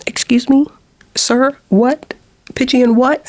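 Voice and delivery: high, incredulous excuse me sir; mock-offended disbelief at the critic; indignant disbelief. Telling viewers her indignant disbelief at his pitchy remark.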